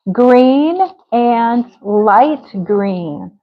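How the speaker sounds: "green and light green" drawn out word by word; slow modelling. Shown as a demonstration. Teacher repeats student's answer, very slowly. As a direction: stretch each "green" slowly.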